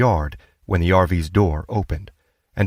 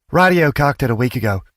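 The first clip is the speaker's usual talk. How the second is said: put-on raised, louder young woman's voice; teenage girl; 7 semitones higher, 4 dB louder than usual. A narrator's reading. Daughter chiming in from the doorway, lifted young voice. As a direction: blurt it out from the doorway.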